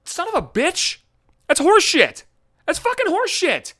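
high-pitched angry venting; indignant outrage. Real, not acted. Actor ranting about never being called back, outraged. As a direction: curse in exasperated disbelief.

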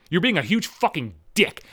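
ordinary delivery

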